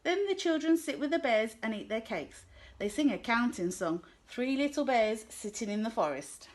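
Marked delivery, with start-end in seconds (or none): none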